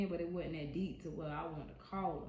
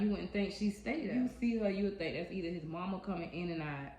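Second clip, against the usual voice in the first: usual speaking voice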